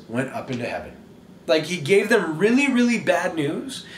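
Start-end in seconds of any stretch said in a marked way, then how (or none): none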